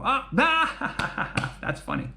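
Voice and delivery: high, amused mock laughter. Listener finally gets the joke, laughing. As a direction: laugh like you just got the joke. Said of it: "you go".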